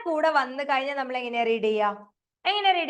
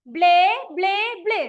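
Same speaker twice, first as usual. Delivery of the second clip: loud chant of ബ്ലേ, ബ്ലേ, ബ്ലേ; teacher modelling saying it out loud; said loudly; 5 semitones higher, 6 dB louder than usual. Shown as a demonstration. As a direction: belt out ബ്ലേ for the class. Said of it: "ഒന്ന് ഉറക്കെ പറഞ്ഞേ".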